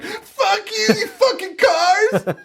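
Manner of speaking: very high, drawn-out screech in imitation; hammed-up screaming at passing cars; mock scream. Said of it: "She's screaming at cars".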